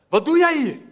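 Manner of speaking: the big brother rounding on the little one; angry brother. Acted. Older brother scolding his little brother, angry snap.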